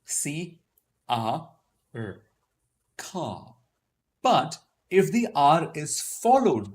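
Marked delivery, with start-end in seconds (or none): 3.0-3.5 "car" as a drawn-out "cah"; British RP "car" with no final R; silent R. Shown as a demonstration. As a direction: let the R vanish from "car". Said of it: "it was preceded by a vowel".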